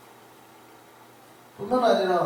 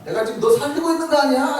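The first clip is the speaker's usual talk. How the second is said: loud, high, indignant donkey protest; the donkey scolds its blind master, loud and high; 9 semitones higher, 7 dB louder than usual. Acted. Preacher voicing the donkey scolding Balaam, loud and high. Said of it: "나귀가 그러잖아요".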